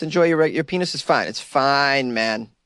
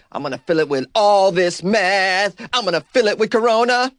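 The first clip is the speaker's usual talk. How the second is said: mock escalating craving; 6 semitones higher, 4 dB louder than usual. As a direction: build up the mock desperation, louder and higher.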